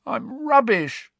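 high, slow, croaky despair; croaked. A narrator's reading. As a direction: croak it out in misery. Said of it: "croaked Ron".

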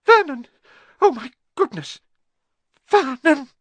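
very high, loud gasps of shock; choked horror. A narrator's reading. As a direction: cry out to her husband as if about to faint. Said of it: "made a choking noise".

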